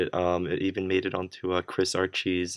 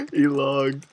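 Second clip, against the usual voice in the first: high giggling through words; laughing; 12 semitones higher, 6 dB louder than usual. Real, not acted. Laughing at troll result.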